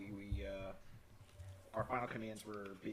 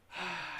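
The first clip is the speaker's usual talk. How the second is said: disappointed sigh; 5 semitones higher than usual. Retold or imitated. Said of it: "she looks all disappointed".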